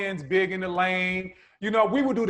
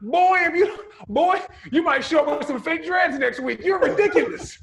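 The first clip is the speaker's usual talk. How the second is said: laughing loud teasing; 9 semitones higher, 5 dB louder than usual. Real, not acted. Teasing cohost about fake accent.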